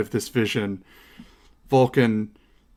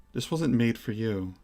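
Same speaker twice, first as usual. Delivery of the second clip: measured, regal goddess; 2 semitones lower, 7 dB quieter than usual. Acted. Goddess considers a gifted weapon before its bearer, measured and soft. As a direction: speak quietly and deliberately as a reserved goddess. Game master voices goddess Valis.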